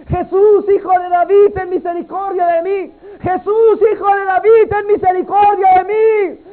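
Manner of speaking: desperate cry. Retold or imitated. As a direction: shout it harder every time. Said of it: "comenzó a gritar".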